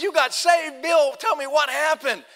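high, loud, thrilled questioning; excited amazement. Retold or imitated. Preacher reacting to Bill's news of being saved, excited. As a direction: burst out in eager disbelief.

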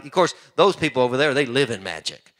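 ordinary delivery

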